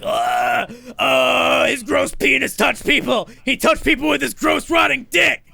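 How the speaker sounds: loud, high, revolted yell; disgusted shout. Retold or imitated. Podcaster ranting about Weinstein, disgusted shout. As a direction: spit it out in disgust.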